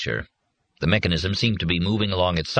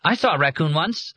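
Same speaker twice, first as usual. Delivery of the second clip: put-on high, piping child's voice; a small child bursting to share; excited child; 11 semitones higher than usual. A narrator's reading. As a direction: pipe it up like an eager kid. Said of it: "a child piped excitedly".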